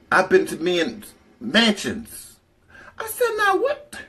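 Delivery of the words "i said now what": amazed squeal. Retold or imitated. Amazed at mansions.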